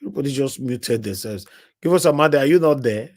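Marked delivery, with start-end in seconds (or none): 1.8-3.1 loud call into silence. Real, not acted. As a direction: shout to check if anyone's there. Calling out to silent caller.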